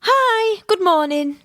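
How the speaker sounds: robotic cheery receptionist hello; fake cheerful phone greeting. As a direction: chirp it like nothing's wrong. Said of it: "if I rung you like that".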